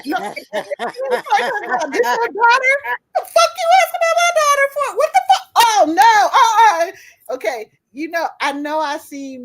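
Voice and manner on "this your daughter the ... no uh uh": high, fast, laughing mock rage; hammed-up angry mother shouting at a stranger; mock furious yelling.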